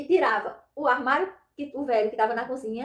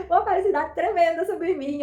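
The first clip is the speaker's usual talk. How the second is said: real laughter breaking into the words, higher; happy laughter; 5 semitones higher than usual. Real, not acted. Woman recalling her joy, laughing for real. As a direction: laugh with joy as you speak. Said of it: "Eu dei uma risada".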